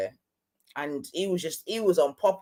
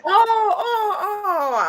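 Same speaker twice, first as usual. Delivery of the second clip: laughing; 4 dB louder, slower than usual. Real, not acted. Caught out, laughing at friend's prompt.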